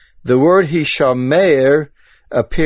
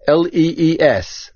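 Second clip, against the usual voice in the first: ordinary delivery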